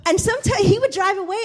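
ordinary talk

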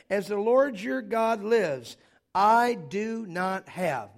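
"As the Lord your God lives, I do not have" read slowly and loudly; slow, loud, drawn-out scripture. Acted. Pastor reading the widow's words to the congregation, slow and loud. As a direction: drag out every word of the widow's plea.

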